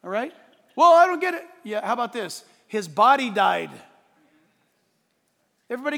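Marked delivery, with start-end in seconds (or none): 0.7-1.5 higher, louder mock protest; mock confused objector. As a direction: play the baffled critic. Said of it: "objector's voice".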